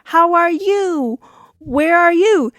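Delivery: high, loud, drawn-out shouted small talk; imitated exaggerated household yelling. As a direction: bellow ordinary chat word by word. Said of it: "they start yelling".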